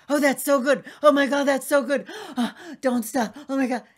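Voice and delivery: high, loud, gasping pleasure cries; mock ecstatic moaning. Telling the hosts what she told every man, in mock ecstasy. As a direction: ham up the lover's delight, gasping. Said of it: "when I said".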